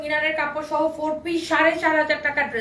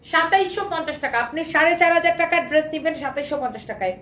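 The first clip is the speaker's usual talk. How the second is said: normal talking voice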